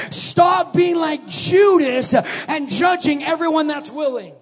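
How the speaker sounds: loud, slow shouted preaching; full-force preaching. Acted. Preacher calling the church to sacrifice, shouting. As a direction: pour it out, shouting.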